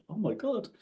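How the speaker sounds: high, hushed, shocked "oh my God"; mock horrified. Strangers overhear him joking and react with high, hushed shock. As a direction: pull back in mock horror. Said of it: "people look at you like".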